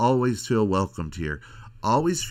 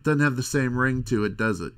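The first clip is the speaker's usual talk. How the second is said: ordinary talk, nothing marked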